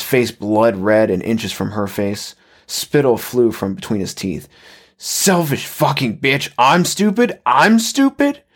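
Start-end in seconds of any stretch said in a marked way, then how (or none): 5.0-8.4 slow, high-pitched rage climbing higher; angry screaming. Acted. Husband raging inches from his wife's face, screaming. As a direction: start raised and climb into an incredulous yell. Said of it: "he was still shouting".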